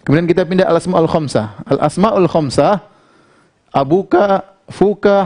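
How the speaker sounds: normal talking voice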